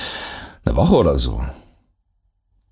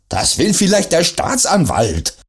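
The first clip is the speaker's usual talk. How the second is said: high, raised mock yell; gebrüllt; 10 semitones higher than usual. A narrator's reading. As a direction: read as a shout, but held back. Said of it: "brüllte Paul". (halb gebrüllt, erzählt)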